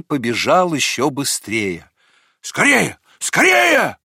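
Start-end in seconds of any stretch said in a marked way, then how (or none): 2.4-4.0 high urgent cry, second one higher; dog's shout. A narrator's reading. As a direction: urge them on, building in pitch. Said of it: "кричала собака". (срочный высокий крик собаки)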